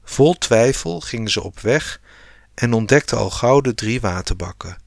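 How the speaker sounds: plain reading voice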